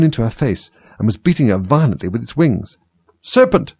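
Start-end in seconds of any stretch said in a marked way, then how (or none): 3.2-3.7 very high-pitched pigeon scream. A narrator's reading. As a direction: cry it out sharply, way up high. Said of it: "screamed the pigeon".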